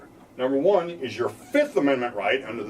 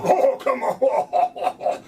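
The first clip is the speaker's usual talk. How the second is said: breathy laughing scoff at the driver; laughing mock cop; 13 semitones higher, 3 dB louder, much slower than usual. Mock cop scoffing at the driver, laughing. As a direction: scoff through a laugh, high and slow.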